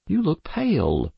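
high, prim mother's voice; a fussy mother noticing her pale daughter; mother remarking. Acted. A mother at dinner remarks on her daughter's pale face, high and prim. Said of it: "Mother remarked".

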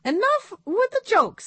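very high squeaky puppet voice; high puppet-show monkey. A narrator's reading.

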